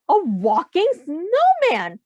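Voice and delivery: high, slow, amazed exclamation. Acted. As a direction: gasp it out in wonder. Townsfolk amazed at the sight.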